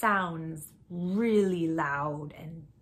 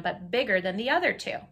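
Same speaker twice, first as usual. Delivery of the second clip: usual speaking voice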